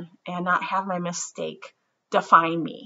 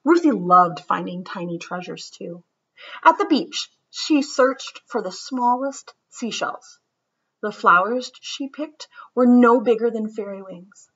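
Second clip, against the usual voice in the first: lively, sing-song storybook read-aloud; reading to children; 5 semitones higher than usual. A narrator's reading. Teacher reads a picture book to her class, lively and sing-song. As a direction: read it like story time for kids.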